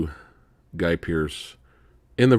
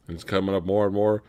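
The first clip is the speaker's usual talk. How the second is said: ordinary delivery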